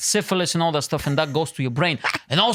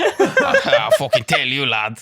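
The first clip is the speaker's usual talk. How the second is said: mock uncle; 4 semitones lower, 4 dB louder, much slower than usual. Uncle voice continued.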